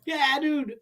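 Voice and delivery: high squeal through laughter; real laughing. Real, not acted. Confirming the absurd pool picture to co-host, high laughing. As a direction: crack up while agreeing, pitch shooting up.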